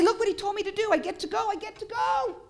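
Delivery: high, loud, gleeful eagerness; excited delight. Acted. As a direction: exult like a kid who's been chosen. Acting out delight at being sent.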